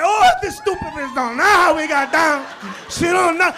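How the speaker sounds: mock possessed rant.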